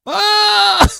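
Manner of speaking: very high, loud "ah"; sudden high-pitched squeal; mock scream. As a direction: let out a piercing comic shriek. Mock scream after "hilarious.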